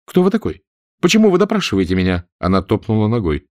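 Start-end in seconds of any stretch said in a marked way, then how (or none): 0.1-2.2 high, fast, impatient woman's questions; lady snapping at the stranger's questioning. A narrator's reading. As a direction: rush her questions, annoyed and uneasy. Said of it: "Она говорила нетерпеливо".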